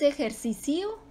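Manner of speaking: ejercicio as e-jer-ci-CI-o, I apart from O; final vowels split. Shown as a demonstration. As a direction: break the last IO of ejercicio into two syllables, questioning. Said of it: "¿O cómo es?".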